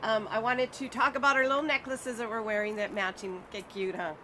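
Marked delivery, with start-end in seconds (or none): none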